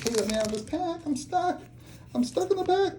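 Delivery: high squeaky voice of a card stuck in the pack; pretend trapped card. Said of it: "She's like".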